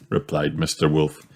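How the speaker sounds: ordinary delivery, nothing marked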